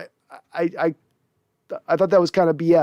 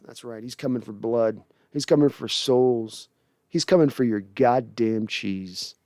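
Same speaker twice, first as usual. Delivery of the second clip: ominous hype; 7 semitones lower, 5 dB quieter than usual. Pretend. Hyping Russ's revenge.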